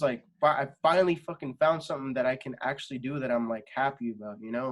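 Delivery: usual speaking voice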